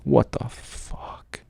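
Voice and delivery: slow, drawn-out whisper of shock; whispered disbelief. Retold or imitated. Told of a grenade threat, whispering stunned disbelief. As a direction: whisper it slowly, barely believing. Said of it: "So I'm like".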